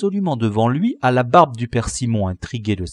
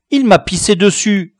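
indignant schoolboy; 7 semitones higher, 6 dB louder than usual. Acted. Camus complains to teacher.

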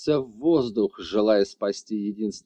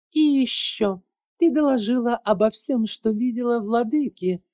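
gloating villain; 10 semitones higher, 3 dB louder than usual. A narrator's reading. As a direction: savour every cruel word. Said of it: "злорадно оскалилась и довольно выдала".

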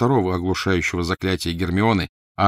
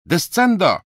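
high, loud spell cry; shouted spell; 11 semitones higher, 7 dB louder, slower than usual. Acted. Crabbe blasts the junk wall at Ron, shouting the spell high and loud. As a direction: fling the spell hard. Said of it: "крикнул".

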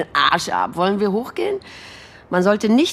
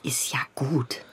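whisper; 5 semitones lower, 9 dB quieter, slower than usual. Acted. Hushed reassurance.

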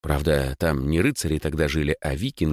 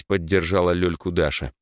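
ordinary delivery, nothing marked

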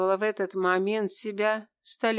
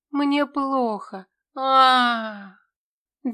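high, loud pained moan, slow; moaning and crying out in pain; стон от боли; 6 semitones higher, 7 dB louder, much slower than usual. Acted. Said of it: "стонала и плакала одновременно". (стонет и плачет от боли)

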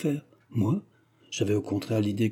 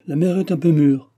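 raised, louder dismissive remark; grimacing distaste; 6 semitones higher, 6 dB louder than usual. A narrator's reading. Brother rejects the older cousin, grimacing distaste. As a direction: say it through a grimace. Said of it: "en faisant la grimace".

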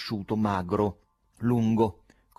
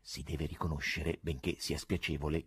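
plain reading voice

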